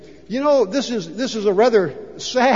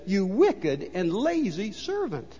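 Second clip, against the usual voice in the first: softer, slow and cold reading; quiet rebuke; 8 dB quieter, slower than usual. Retold or imitated. Master rebuking the servant, quiet and slow. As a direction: let the rebuke sink in quietly. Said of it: "But the man says".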